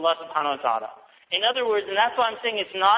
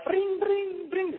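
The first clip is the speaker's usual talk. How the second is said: high, far-off imitation of a ringtone; mock cell phone ring, a lot higher; 12 semitones higher, much slower than usual.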